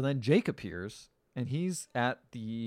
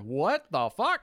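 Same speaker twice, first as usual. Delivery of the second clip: stunned; 3 semitones higher, 3 dB louder, much slower than usual. Retold or imitated. Her stunned reaction to the question.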